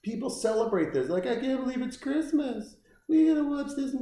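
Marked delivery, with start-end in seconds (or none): 1.3-4.0 high, mocking gush of a Christmas fan. Mocking the fans who celebrate this movie, in a high gushing voice. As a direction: lightly sneer as a gushing fan.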